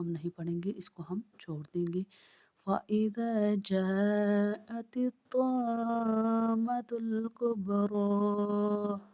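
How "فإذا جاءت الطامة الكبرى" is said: slow melodic tajweed chant; Quran recitation. Acted. Teacher reciting the verse for pupils, slow melodic chant.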